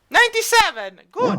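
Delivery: high, loud burst of surprise; excited announcement. Real, not acted. Game master reveals a high percentage roll, excited surprise. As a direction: call out the lucky roll, pitch leaping.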